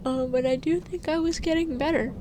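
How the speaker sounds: talking through a yawn; words stretched by a yawn. Real, not acted. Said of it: "I apologize if I yawn and keep talking".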